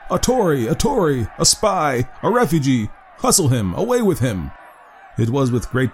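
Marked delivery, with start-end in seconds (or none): 0.1-4.5 higher, mock angry crowd yelling; crowd shout. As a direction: hurl it at him like an angry mob. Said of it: "a general shout burst from the bystanders".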